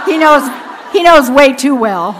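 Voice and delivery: loud, laughing admission. Real, not acted. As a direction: blurt it out loudly through laughter. Laughs about little Jericho knowing her.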